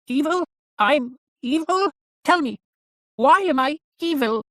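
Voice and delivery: very high, slow puppet voice; puzzled evil doll. A narrator's reading. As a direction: question it slowly in a high doll voice. Narrator voices the doll questioning.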